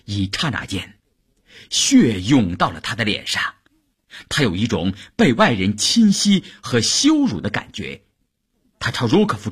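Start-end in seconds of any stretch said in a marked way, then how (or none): none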